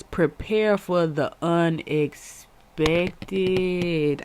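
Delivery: drawn-out oracle reading; slow card message read aloud; slow solemn reading. Shown as a demonstration. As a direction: read each word slowly and gravely. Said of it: "Uh, and it says".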